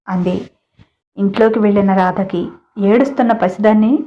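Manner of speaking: plain reading voice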